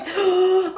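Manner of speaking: high, loud intake of breath; shocked gasp. Retold or imitated. Embarrassed customer realizing the mess, acted gasp. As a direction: gasp in mock mortification.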